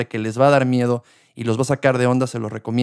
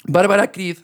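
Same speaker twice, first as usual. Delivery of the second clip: voice cracking; 6 semitones higher than usual. Real, not acted. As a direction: voice cracks on the name. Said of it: "se me salió el gallo".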